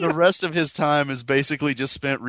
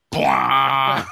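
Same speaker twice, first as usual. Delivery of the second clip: loud, flat dismissive blah shot down the phone; 5 dB louder than usual. Retold or imitated. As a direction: brush off the question with a shouted blah. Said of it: "answering more technical support questions with".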